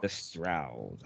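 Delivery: "The Strouds" dropped low and soft; deep mock voice. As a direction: growl the name low and quiet.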